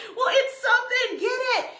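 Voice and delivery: high-pitched frantic insisting; re-enacted panic. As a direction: act out demanding a rescue. Retells panicked argument with husband.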